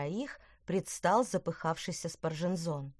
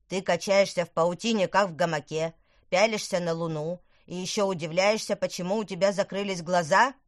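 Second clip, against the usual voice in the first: loud, furious scolding; boiling over; 6 dB louder than usual. Acted. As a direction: scold him, seething with rage. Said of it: "вскипел Тыквер". (вскипая от гнева)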